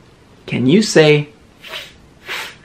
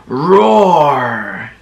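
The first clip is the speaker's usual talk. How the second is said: growly mock tiger roar; a playful, lightly growled roar. Shown as a demonstration. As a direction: roar like a friendly tiger for kids. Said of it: "Tigers say".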